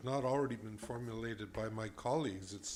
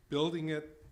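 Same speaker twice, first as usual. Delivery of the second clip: normal talking voice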